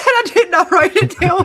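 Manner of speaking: high, loud mocking mimic through laughter; laughing imitation. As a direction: parrot her answer while cracking up. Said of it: "She said".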